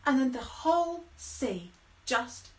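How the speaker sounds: ordinary delivery, nothing marked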